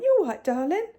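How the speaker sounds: put-on high, loud call to a child; sing-song call. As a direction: call out sweetly to the next room. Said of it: "I went".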